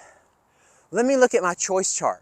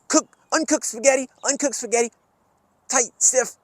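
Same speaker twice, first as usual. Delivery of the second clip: higher, louder clenched calling out; tense strained voice; 6 semitones higher, 4 dB louder than usual. Shown as a demonstration. Coach calls out uncooked spaghetti to kids, strained and tight. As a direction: strain like a stiff uncooked noodle. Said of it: "make yourself stiff".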